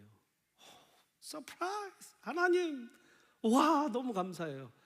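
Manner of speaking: thrilled surprise. Pretend. Believer reacting to God's blessing, hammed-up delight. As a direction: gush over God's gift. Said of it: "좀 이런 반응들이".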